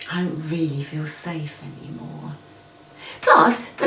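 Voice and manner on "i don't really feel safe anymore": ordinary talk, nothing marked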